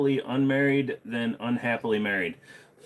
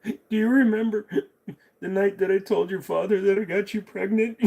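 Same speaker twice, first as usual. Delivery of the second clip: higher voice breaking into laughter; laughing through the line; 10 semitones higher, 4 dB louder than usual. Acted. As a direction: barely hold the laugh in. Old man in joke, laughing.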